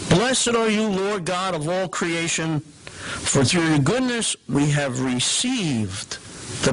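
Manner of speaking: new prayer text. Shown as a demonstration. Lecturer reads the new offertory prayer, liturgical. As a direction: read it as the priest prays it at Mass. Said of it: "I'm gonna read the new prayer".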